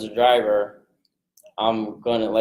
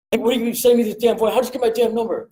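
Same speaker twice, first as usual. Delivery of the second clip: higher, fast mock angry caller; irate caller; 9 semitones higher, faster than usual. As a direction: play the caller who's fed up. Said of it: "I'm right back at him".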